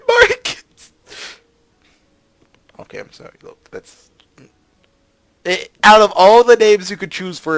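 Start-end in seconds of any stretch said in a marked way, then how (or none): 0.1-0.6 high, loud laughing yell; laughing shout. Real, not acted. Repeating name, laughing. 2.8-4.1 breathless whisper. Real, not acted. Recovering from laughter.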